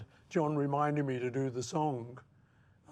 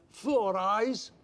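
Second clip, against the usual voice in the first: Four Eyes jeered a touch higher and louder; mock taunt; 6 semitones higher, 4 dB louder than usual. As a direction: tease him like a schoolboy, Four Eyes. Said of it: "I would call him Four Eyes".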